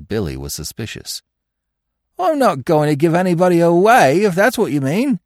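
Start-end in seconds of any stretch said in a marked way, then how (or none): 2.2-5.2 high, loud sullen refusal; stubborn, sulky crook. A narrator's reading. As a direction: refuse defensively, voice pushed up. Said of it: "he said sullenly".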